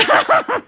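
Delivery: short high burst of laughter. Real, not acted. Laughing that bestie got mad.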